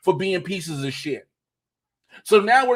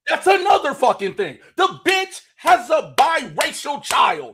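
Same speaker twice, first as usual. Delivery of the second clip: outraged outburst; 8 semitones higher, 3 dB louder than usual. Real, not acted. Sudden outraged remembering.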